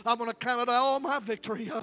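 loud, high, driving preacher's call; preaching at full force. Acted. Pastor urging the congregation to let go, in a full-force preaching shout. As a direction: build it up and shout it to the rafters.

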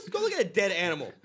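usual speaking voice

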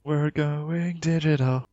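high, slow, drawn-out put-on wonder; mock dramatic wonder. Co-host joining in on the big reveal, mock wonder. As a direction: stretch it out high like a silly revelation.

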